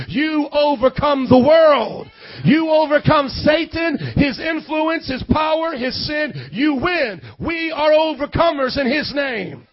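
loud, emphatic sermon with stretched key words; preaching at full force. Real, not acted. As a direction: drive each declaration home to the congregation.

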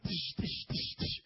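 whispered tish tish sounds like quick jabs; mock jab noises. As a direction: imitate verbal jabs with hissing sounds. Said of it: "And then it's like".

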